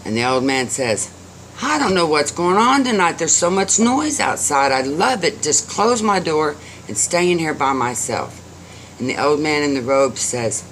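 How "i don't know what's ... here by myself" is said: put-on higher-pitched, fussy old man's complaint; grumbling complaint. A narrator's reading. Old man complains to robed guest, put out. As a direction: complain with raised pitch, settling down.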